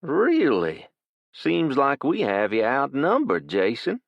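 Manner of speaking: South Louisiana drawl. Acted. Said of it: "thick South Louisiana drawl".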